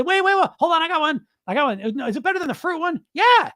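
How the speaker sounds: very high, squeaky interruption; hammed-up giddy squeal; mock hysterical executive. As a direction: play an executive bursting to top the last joke. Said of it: "they're laughing so hard".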